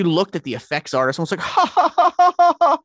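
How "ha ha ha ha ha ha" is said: high, loud mocking actor laugh; impression of a laugh. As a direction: cackle like the drunk star, pitched way up. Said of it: "you do the perfect freaking impression of his laugh".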